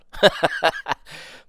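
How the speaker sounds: loud airy laugh; burst of laughter. Real, not acted. Laughing at the move.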